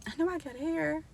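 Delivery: high, sassy mock challenge; challenging. Vlogger scolding her own shrinkage, high sassy challenge. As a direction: call out the hair like it's playing you. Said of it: "'cause I be like".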